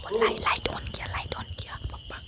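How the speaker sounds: tense hushed whisper. Real, not acted. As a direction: whisper in alarm as the light comes on. Light switched on, hiding.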